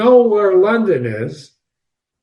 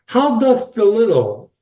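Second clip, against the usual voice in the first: lightly voiced child reciting a lesson, a little higher; reciting like Alice; 5 semitones higher than usual. Alice starts reciting her lesson to herself, sing-song recital. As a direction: recite it like a schoolgirl saying lessons. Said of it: "she starts reciting something".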